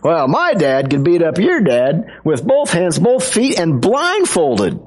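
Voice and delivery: high-pitched mock boast, drawn out at the end; bragging boy. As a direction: top the other kid, stretching the final word. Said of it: "it's just kids bragging".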